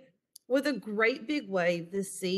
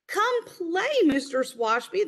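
much higher, louder call; girl calling the grumpy neighbour to play; 10 semitones higher, 3 dB louder than usual. A narrator's reading. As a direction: call up to the house invitingly. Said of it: "the girl called back".

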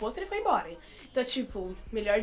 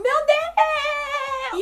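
very high, loud fangirl-style cry; thrilled squeal, re-enacted; 6 dB louder than usual. Siblings hearing she will come, re-enacted thrilled squeal.

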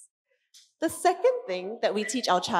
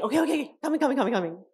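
higher, hurried and flustered; rushing panic; 4 semitones higher, much faster than usual. Retold or imitated. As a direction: headless chicken parent rushing. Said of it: "kanchiong spiders".

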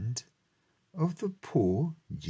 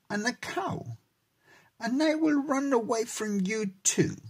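gingerbread man; 9 semitones higher than usual. A narrator's reading. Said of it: "said the cookie".

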